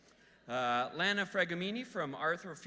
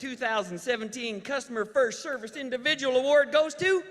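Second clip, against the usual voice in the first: louder and higher as it builds; dramatic announcement; 8 semitones higher, 7 dB louder than usual. Acted. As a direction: build suspense to the winner.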